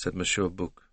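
usual speaking voice

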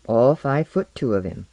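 ordinary delivery, nothing marked

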